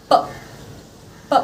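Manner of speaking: P said as a short voiceless "p" pop, no vowel; bare P sound. Shown as a demonstration. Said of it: "the sound of this letter is".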